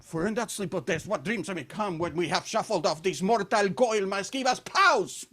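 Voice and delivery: hammed-up soliloquy in a heavy Spanish accent; Spanish-accented Shakespeare. Comedian shows off his Shakespeare, in a Spanish accent. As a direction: declaim it with a thick Spanish accent. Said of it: "my Shakespeare with your Spanish accent".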